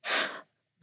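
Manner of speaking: quick breathy gasp of shock; ladybird's shocked gasp; sharp gasp. Acted. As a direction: gasp at the sight. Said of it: "the ladybird cried".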